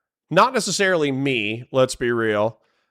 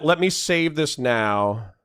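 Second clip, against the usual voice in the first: ordinary talk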